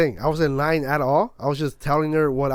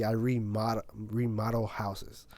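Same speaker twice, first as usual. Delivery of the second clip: slow, soft, savoring the fancy word "remodel"; fancy-sounding; 4 semitones lower, 10 dB quieter than usual. Retold or imitated. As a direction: say "remodel houses" slowly and quietly, as if it's a posh job title. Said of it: "To make it mo-, sound more fancy".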